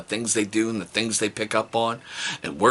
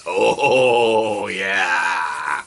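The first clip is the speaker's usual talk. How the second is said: louder gleeful oh yeah; gleeful mock cry; 4 dB louder than usual. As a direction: relish it hammily. Gleeful birthday anticipation.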